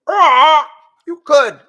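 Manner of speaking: high, loud bird crow; shrill it out like a crowing bird. A narrator's reading. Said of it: "it crowed".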